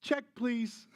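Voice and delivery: high, prim "check, please"; snooty diners. Retold or imitated. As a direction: sniff like a fussy customer. Fellow diners want to leave.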